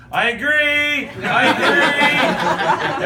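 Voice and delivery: high, loud, drawn-out holler; hammed-up shout, each word stretched; mock yelling out. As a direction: holler it absurdly down the road. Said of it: "walking down the road saying".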